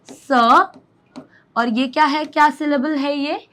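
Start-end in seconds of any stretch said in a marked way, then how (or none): 0.1-0.3 स on its own, a short "suh"; isolated letter sound. Shown as a demonstration. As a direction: sound out स by itself after a pause. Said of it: "इसमें भी दिख रहा होगा स".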